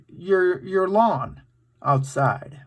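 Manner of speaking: usual speaking voice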